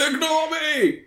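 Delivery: high mock cry. Joking flaw name called out.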